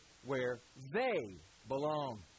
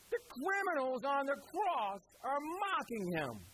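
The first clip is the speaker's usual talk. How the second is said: much higher and slow, every word leaned on; emphatic climax; 9 semitones higher, slower than usual. Acted. Preacher stressing that even criminals mock Jesus, slow and high. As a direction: lift it high and draw it out.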